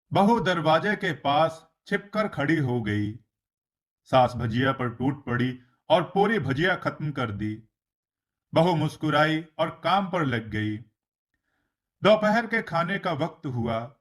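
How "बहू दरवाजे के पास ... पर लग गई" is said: ordinary delivery, nothing marked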